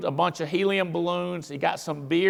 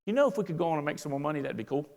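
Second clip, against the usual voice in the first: mock empathy; 6 dB quieter than usual. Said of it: "I'm like".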